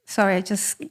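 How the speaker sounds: ordinary delivery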